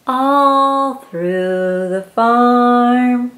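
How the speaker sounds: drawn out slowly; slow refrain. Acted. Reading to a child, slow sing-song refrain. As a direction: stretch each word out.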